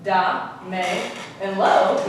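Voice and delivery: "da, me, and lo" as separate beats with pauses; syllable by syllable. Shown as a demonstration. Teacher divides the word for class, "da, me, and lo" one syllable at a time. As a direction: break "damelo" apart, pausing between syllables. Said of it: "this word is divided into three syllables".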